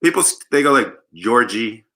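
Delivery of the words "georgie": normal talking voice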